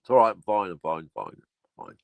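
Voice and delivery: fading, strained after a cough; hoarse after coughing. Real, not acted. Reassuring viewers after a coughing fit, strained. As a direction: insist you're fine while still choking.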